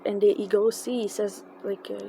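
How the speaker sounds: ordinary delivery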